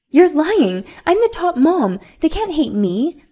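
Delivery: high, loud, indignant spoiled mom; shrill bratty mom. Acted. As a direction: fire back like an entitled rich mom. Bratty rich mom shouting back.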